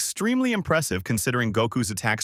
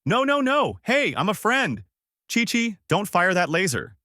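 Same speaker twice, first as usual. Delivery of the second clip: mild panicked yell; 9 semitones higher than usual. Acted. Yamcha begging Chi-Chi not to fire, mild high yell. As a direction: yell it out high, without full volume. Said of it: "yells out".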